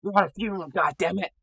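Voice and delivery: high, strained mock telling-off; mock scolding whisper. Captain breaking up a funeral brawl, mock scolding whisper. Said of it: "he's been whispering".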